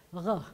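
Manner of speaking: "Ruh." from the back of the throat; a French-style throaty R on "Ruh."; guttural resh. Shown as a demonstration. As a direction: Hebrew resh, gargled deep in the throat. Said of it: "comes from the back of your throat, like this".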